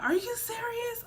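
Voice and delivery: mock-shocked friend, voice jumping high; high shocked question. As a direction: play the stunned friend squeaking it out.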